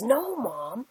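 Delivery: earnest retold protest, pitch lifting at the end; very serious. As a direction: imitate a son seriously correcting mom. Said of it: "he's very serious".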